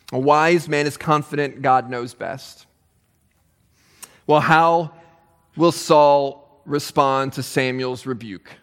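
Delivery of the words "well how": ordinary talk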